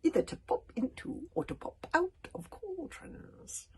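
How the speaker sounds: slow whispery witch, cold as ice; dry icy witch voice. A narrator's reading. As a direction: hiss it slowly like an old witch. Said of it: "her voice as dry as ice".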